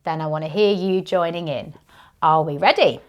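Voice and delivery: ordinary talk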